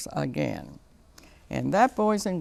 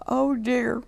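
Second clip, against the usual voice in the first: put-on higher wee baby bear cry; a small cub's dismayed little cry; baby bear's wee voice; 7 semitones higher than usual. A narrator's reading. Baby bear sees his chair, higher wee voice. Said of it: "cried the baby bear in his wee little voice".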